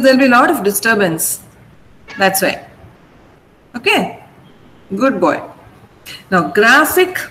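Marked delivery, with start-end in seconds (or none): none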